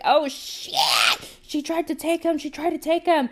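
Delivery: high, loud, panicked cries; freaking out. Retold or imitated. Family realizing the witch came for baby, freaking out. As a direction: exclaim it in acted-out alarm. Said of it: "they're freaking out".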